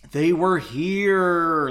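slow, hushed-dread first try; slow fearful murmur. Acted. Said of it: "in an almost fearful voice".

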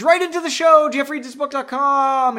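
high, loud mock jingle-like shout; announcer plug. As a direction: belt out the plug, high and stretched. Mock announcer plug mid-story.

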